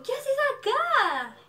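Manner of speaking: high, drawn-out disbelief; astonished. Retold or imitated. Dreamer finds her idol behind her, astonished squeal. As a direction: squeal the question, stretched and loud.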